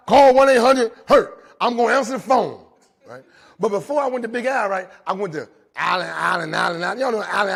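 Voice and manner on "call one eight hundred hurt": louder, slow TV lawyer jingle; ad slogan. Retold or imitated. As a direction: boom it like the commercial.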